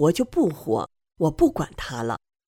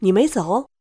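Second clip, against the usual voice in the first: surprised; 4 semitones higher, 4 dB louder than usual. Retold or imitated. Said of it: "他吃惊地看着我说".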